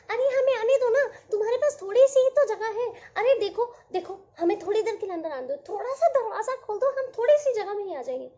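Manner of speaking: high, loud, fast pleading of tiny raindrops; pleading little droplets. Acted. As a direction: beg to be let in like tiny drops.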